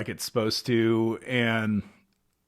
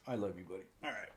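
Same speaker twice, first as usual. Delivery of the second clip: tender; 5 semitones lower, 18 dB quieter, much faster than usual. Real, not acted. Talking softly to the dog.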